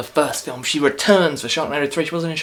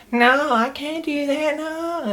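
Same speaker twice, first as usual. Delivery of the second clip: much higher, slow, wooden whine; mocking bad acting; much slower than usual. Mocking Tara Reid's terrible acting as she refuses, high and slow. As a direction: overplay her stiff line delivery. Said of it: "her acting is terrible".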